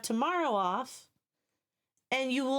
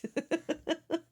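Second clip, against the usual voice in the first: laugh; 7 semitones higher than usual. Real, not acted. Laughing at chat.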